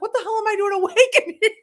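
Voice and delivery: lightly voiced confused patient waking up; groggy alarm. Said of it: "I'm like".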